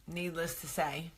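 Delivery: ordinary talk, nothing marked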